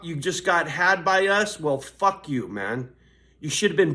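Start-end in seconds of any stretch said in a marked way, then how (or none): none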